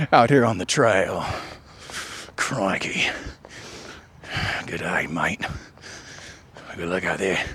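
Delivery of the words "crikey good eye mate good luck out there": whispered mock Australian accent, low; hushed playful Aussie impression. Runner jokes to listener in a whispered Australian accent. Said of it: "That's right, I dropped the accent".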